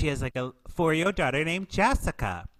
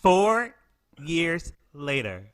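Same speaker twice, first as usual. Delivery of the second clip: title-card announcement; 2 semitones higher, much slower than usual. Acted. Host announcing the movie's time jump, mock-dramatic narrator. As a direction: announce it slowly with pauses between words.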